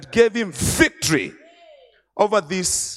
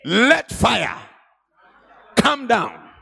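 Elijah's fiery call; 4 semitones higher, slower than usual. Acted. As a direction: thunder it down like a prophet. Preacher acts Elijah calling fire.

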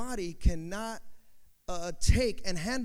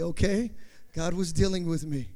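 ordinary delivery

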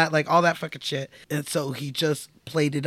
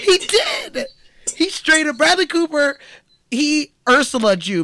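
much higher, laughing and louder; laughing outburst; 11 semitones higher, 5 dB louder than usual. Real, not acted. Cohost laughing that Bradley stole Sam's voice, high and loud. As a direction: crack up while accusing him.

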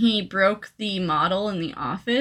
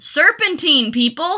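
mock shout; 7 semitones higher, slower than usual. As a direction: yell it like a drill sergeant. Co-host mocking the villain.

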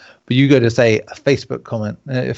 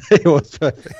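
laughing; 4 semitones higher, 4 dB louder than usual. Real, not acted. Laughing at the sound effect.

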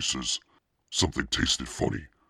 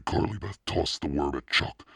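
ordinary delivery

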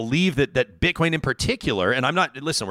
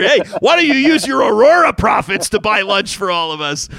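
loud, high teasing ask; mock cheeky request; 8 semitones higher, 8 dB louder than usual. Host teasing the CEO about paying for lunch, loud and mocking. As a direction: rib her with a cheeky demand.

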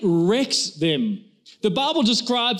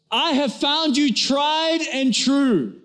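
repeated for deaf wife; 6 semitones higher, much slower than usual. Retold or imitated. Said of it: "He goes".

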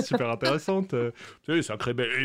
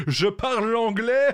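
laughing; 6 semitones higher, 3 dB louder than usual. Real, not acted. Laughing while boasting English.

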